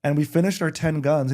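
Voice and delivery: ordinary delivery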